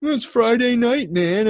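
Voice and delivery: high, drawn-out mocking protest; a little attitude. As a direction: mimic a whiny guy talking back. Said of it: "he had a little attitude".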